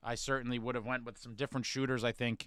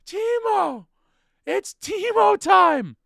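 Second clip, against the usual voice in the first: high, loud drawn-out shout; yelling; 12 dB louder, much slower than usual. Retold or imitated. Fan screaming for Timo again, high, loud and slow. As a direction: bellow it slowly like a chant. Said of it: "I'm like".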